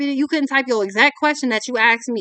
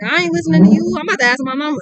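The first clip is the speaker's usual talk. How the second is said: louder, higher defiant brush-off; mock a stubborn refusal, loud and high; louder, higher "I ain't listening" brush-off, mocking a stubborn person; defiant mocking quote; 5 semitones higher, 4 dB louder, faster than usual. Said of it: "This is somebody being like".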